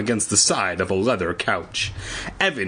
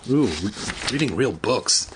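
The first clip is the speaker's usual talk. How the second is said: plain reading voice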